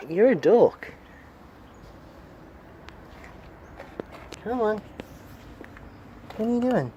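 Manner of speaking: high-pitched coaxing voice for a cat; high baby talk to a pet cat. Acted.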